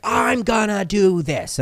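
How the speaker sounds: drawn-out, high-pitched mock bravado; gung-ho bravado. Acting out someone setting out overconfidently, gung-ho. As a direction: announce it big and slow, stretching every word.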